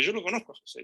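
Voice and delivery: ordinary delivery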